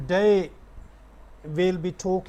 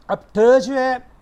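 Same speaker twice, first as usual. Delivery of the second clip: ordinary delivery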